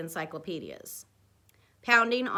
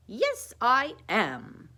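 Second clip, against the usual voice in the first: very slow, higher mock-British Sherlock accent; Sherlock Holmes accent; 5 semitones higher, 6 dB louder, much slower than usual. A narrator's reading. Dwight insisting he will investigate, slow Sherlock accent. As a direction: draw out the Sherlock accent, louder. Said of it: "said Dwight again".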